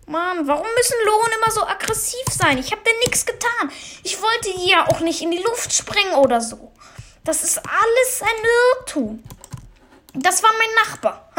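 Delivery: high, whiny protest of innocence; whiny pleading. Acted. Gamer whining at the hostile mob, high and pleading. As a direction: whine that you did nothing wrong.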